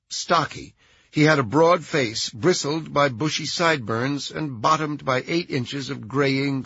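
ordinary delivery

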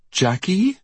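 high, upset rising question; voice cracking. A narrator's reading. Tearful Diane appealing to sleeping Jackie, voice cracking. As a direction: call her name, voice lifted and breaking. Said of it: "Diane's voice cracked".

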